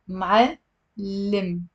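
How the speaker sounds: "Ma'allim" split slowly into m'al and lim; deliberate syllable-by-syllable "Ma'allim"; two syllables. Shown as a demonstration. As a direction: say "Ma'allim" in two beats with a clear gap. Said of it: "it's also two syllables".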